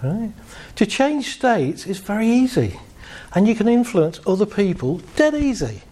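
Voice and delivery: normal talking voice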